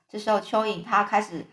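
ordinary delivery, nothing marked

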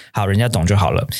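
ordinary talk, nothing marked

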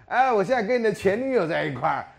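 nosy male friend. Retold or imitated. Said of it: "有一个男的跑过来说".

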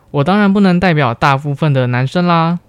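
ordinary talk, nothing marked

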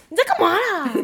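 imitating an upset friend yelling, high and loud; mock angry yell. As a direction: shout back the friend's outrage. Friend yells at mosquito kill.

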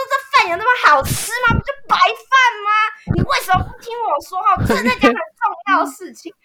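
high, loud exasperated rant; retold furious complaint; indignant outburst. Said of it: "我想说".

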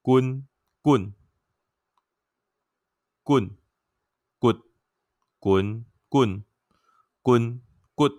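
滚 棍 read as 君 through all eight Taiwanese tones, loud and clearly; Taiwanese tone demo. Shown as a demonstration. Former Chinese major showing the eight tones of 君, deliberate. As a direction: chant 滚、棍、棍 tone by tone. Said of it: "就顺势就把它念下来了".

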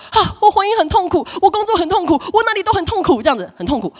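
high, loud moaning lament; a church member wailing about their pain; whiny complainer. Retold or imitated. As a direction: moan each woe like a needy member. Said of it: "来到你面前说".

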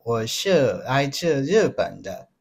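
put-on slow, careful Japanese accent in Mandarin. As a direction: speak Mandarin like a Japanese visitor. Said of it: "有一点会模仿那个日本人讲中文".

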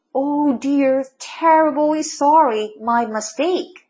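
slow, drawn-out apology; apologetic. A narrator's reading. Mole to the rabbit family, apologetic. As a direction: stretch out a regretful sorry. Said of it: "鼹鼠先生满怀歉意地".

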